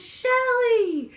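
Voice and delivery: loud, high-pitched cry of a name; shocked mock yell. Friend yells at the girl puking on her, mock shocked shout. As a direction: call out in horrified alarm, hammed-up. Friend reacts to being puked on.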